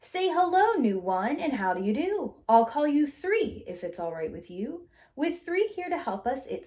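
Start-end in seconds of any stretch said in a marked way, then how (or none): none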